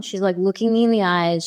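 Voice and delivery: normal talking voice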